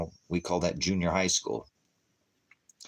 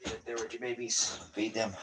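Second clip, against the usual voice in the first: much softer, said to himself; soft echo; 4 semitones higher, 8 dB quieter than usual. Real, not acted. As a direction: let it fall to a near-murmur. Quiet echo after a long pause.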